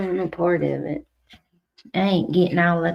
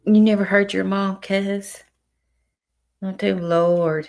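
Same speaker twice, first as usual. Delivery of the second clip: usual speaking voice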